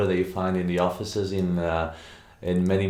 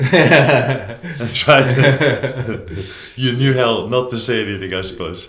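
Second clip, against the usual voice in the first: chuckling through a friendly jab; laughing tease; 4 semitones higher, 9 dB louder, slower than usual. Real, not acted. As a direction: laugh while teasing your friend. Teasing guest, laugh heard.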